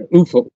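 "ufo" said as a single word, oo-fo; ufo as one word. Shown as a demonstration. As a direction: say "ufo" like a word, not letters. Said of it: "they say it like it's a word".

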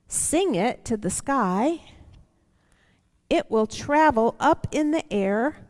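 gentle storybook reading with slow pauses; reading to children. Acted. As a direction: read it aloud like a picture book for the kids. Reading picture book to children.

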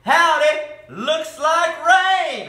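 high, loud, drawn-out holler; yelled. A narrator's reading. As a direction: yell it out over the singing. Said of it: "Holler yelled over the singing".

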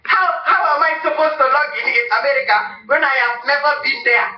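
normal talking voice

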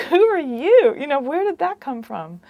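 loud, high, incredulous laughing reaction; baffled disbelief. Retold or imitated. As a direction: exclaim it in amused bewilderment. Mother's retold baffled reaction.

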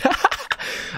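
airy high-pitched giggle; a quick unvoiced squeal of a laugh; real high breathy laugh; breathless high laugh. Real, not acted. Laughs at the [name] line.

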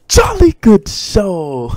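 very high, loud and slow mock English; squeaky exaggerated British toff; hammed-up British accent. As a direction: stretch it out like an over-the-top posh Brit. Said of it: "I wish I had the accent".

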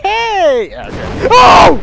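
sudden high yelp; startled shout. Real, not acted. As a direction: jolt at a loud noise. Sudden scare in game.

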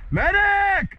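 yelling for a medic; man down shout. Real, not acted. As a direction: call out loud for help. Calling for medic after hit.

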